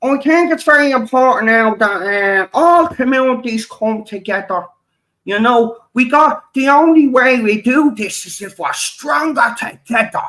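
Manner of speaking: high mock-earnest preachy voice; mocking YouTuber. Mimicking YouTubers who preach unity, high and mocking. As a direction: parody their sanctimonious call for unity. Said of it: "You go".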